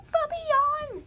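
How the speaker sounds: normal talking voice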